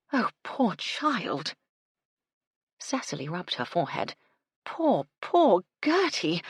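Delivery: slow, aching sympathy; pitying. Acted. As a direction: mourn for the poor girl. Cecily pitying the abandoned Gertie.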